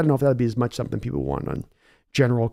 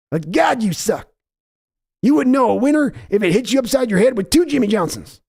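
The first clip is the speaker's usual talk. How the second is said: high, barking bully dad scolding his son; Jerry Jones berating his son; angry father; 9 semitones higher than usual. Acted. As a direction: tear into the son.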